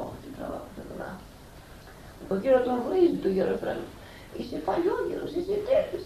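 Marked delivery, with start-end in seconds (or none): 0.1-1.3 a fast whispered sigh of weariness; whispered lament. Retold or imitated. Old elder whispering his sigh over the troublesome monk. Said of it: "λέει". 4.4-6.0 high, mock indignant voice of the angry monk; mock insulting rant. Angry monk scolding old Ephraim, high mock insult.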